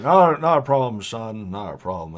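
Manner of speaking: put-on mild old farmer voice; a lightly voiced friendly old-timer; easygoing old farmhand, lightly done. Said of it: "He's like".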